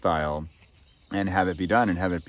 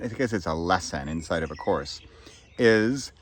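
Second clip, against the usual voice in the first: ordinary talk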